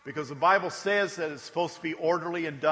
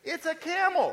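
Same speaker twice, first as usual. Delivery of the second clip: put-on much higher proud kid's voice; child voice; 11 semitones higher than usual. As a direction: announce it like a small child. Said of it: "your grandkids or your kids come up to you".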